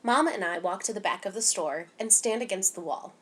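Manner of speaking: usual speaking voice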